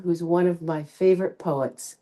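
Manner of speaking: ordinary talk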